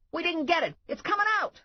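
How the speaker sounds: high alarmed call, rising; alarmed shout. Acted. Carl warning the snake is coming, high alarmed shout. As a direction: warn in a panic.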